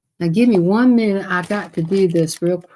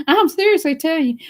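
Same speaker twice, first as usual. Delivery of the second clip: ordinary talk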